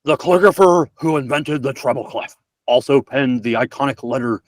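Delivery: a fair stab at Nixon. Pretend. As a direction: read it like Richard Nixon. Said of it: "gonna have to go with Nixon".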